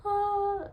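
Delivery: doctor's high startled ah; surprised exclamation. Retold or imitated. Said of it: "the doctor goes".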